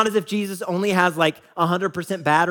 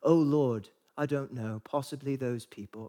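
hushed, falling, softer holier-than-thou reply; mock pious; 5 semitones lower, 8 dB quieter than usual. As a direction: lightly parody a pious disciple, dropping away. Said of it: "something really holy and pious".

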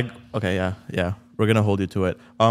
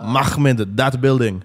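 Mahmoud drawn out very slowly, then that building punched loud, in a Persian accent; mock Persian accent; 3 semitones higher, 4 dB louder, much slower than usual. Shown as a demonstration. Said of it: "Give me words to say".

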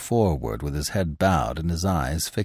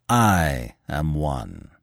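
slow, steady and unhurried; calm; 4 semitones lower, much slower than usual. A narrator's reading. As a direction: say it with cool composure. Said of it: "repeated the stranger calmly".

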